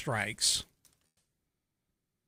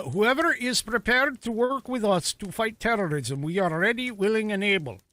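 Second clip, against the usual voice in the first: higher-pitched imitation Nigerian accent; put-on Nigerian accent; 5 semitones higher than usual. Host quoting Nigeria's minister for fun, put-on Nigerian accent. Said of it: "I threw that accent in there".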